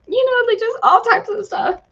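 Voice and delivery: fighting off crying as it rises; breaking into tears. Real, not acted. Retelling begging for her dog, voice breaking high. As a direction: voice cracking high on the edge of tears.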